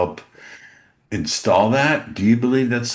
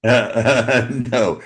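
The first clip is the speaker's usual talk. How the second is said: ordinary delivery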